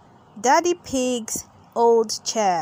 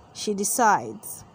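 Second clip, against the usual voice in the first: ordinary delivery, nothing marked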